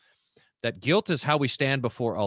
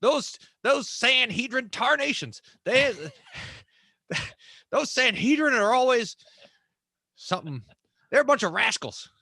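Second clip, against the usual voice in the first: put-on best Texas accent; 10 semitones higher than usual. Texan railing at the Sanhedrin for laughs, hammed-up high Texas accent. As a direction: ham it up as an indignant old-time Texan. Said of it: "that's my best Texas accent".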